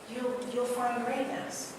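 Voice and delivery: ordinary talk, nothing marked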